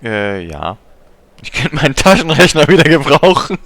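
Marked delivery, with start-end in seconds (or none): 1.4-3.6 joking character voice. Pretend. Jokingly voicing April's calculator quip.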